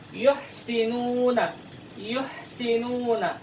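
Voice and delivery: "Yuhsinuna" slow with an airy h; Arabic ha pronunciation; breathy Arabic ha. Shown as a demonstration. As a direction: fill the mouth with breath on the h. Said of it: "rongga mulut harus dipenuhi oleh nafas".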